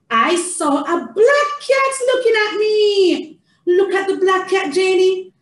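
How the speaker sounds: high, sing-song storytime voice; theatrical reading to a child. Shown as a demonstration. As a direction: cheer a toddler through the page.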